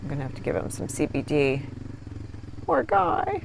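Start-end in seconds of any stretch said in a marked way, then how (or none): 2.7-3.4 much higher coo of pity; high sympathetic coo to Ron; cooing sympathy. Real, not acted. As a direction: coo at the frightened dog. Cooing to scared dog.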